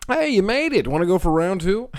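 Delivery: big brother taunting, mock friendly; mock cheery brother. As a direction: tease the little brother with fake cheer. Brothers taunting after duct tape.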